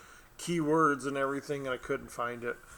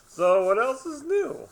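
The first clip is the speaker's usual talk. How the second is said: silly high voice; 13 semitones higher, 9 dB louder, slower than usual. Pretend. Put-on high voice.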